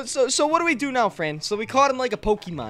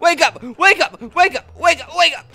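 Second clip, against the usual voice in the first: repeated yell; 5 semitones higher, 4 dB louder than usual. Acted. Yelling at the captured demon.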